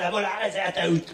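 ordinary delivery

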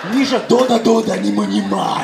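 a lightly put-on Russian mother calling her son. Russian mom at the playground calling Misha, mock Russian accent. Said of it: "אמא רוסייה".

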